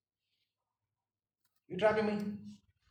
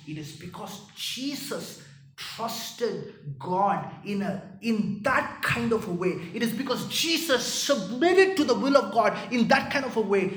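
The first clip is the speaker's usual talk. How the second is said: forceful preaching; 5 semitones higher, 10 dB louder than usual. Real, not acted. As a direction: drive the point home. Third preaching climax.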